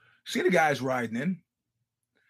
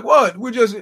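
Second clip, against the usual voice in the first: loud, higher startled "What?"; startled protest; 5 semitones higher, 11 dB louder than usual. Retold or imitated. Feds caught off guard by the woman, startled and loud.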